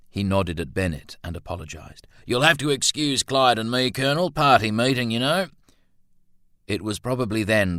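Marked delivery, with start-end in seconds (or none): 2.3-5.5 raised, apologetic comrade's voice; Milton's voice. A narrator's reading. Milton excusing himself to the colonel, high and offhand. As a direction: excuse yourself in a higher, louder character voice.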